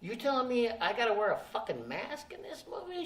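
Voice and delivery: high-pitched, indignant disbelief; incredulous, mildly pissed-off challenge. Retold or imitated. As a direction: retell a mounting protest without shouting. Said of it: "she can see I'm getting pissed off".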